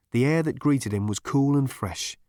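plain reading voice

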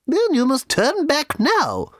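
high, slow, severe refusal; severe little lady. A narrator's reading. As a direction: scold them slowly in a high little voice. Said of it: "said the little lady severely".

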